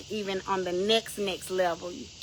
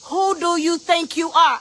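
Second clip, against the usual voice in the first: loud, high challenge; indignant rebuke; 6 semitones higher, 5 dB louder than usual. Acted. Rebuking someone who sets conditions for God, indignant. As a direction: cut in sharply with raised voice. Rebuke cutting into acted bargaining prayer.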